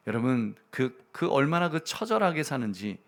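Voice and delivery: ordinary talk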